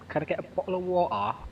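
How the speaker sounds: mock London accent asking for water. Streamer imitates a London accent ordering water. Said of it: "that's very London".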